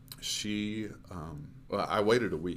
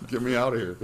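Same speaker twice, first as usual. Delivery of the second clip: louder, laughing through the words; 8 semitones higher, 14 dB louder, faster than usual. Real, not acted. Laughing with the host about wanting out, loud laugh. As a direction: laugh it out, half joking.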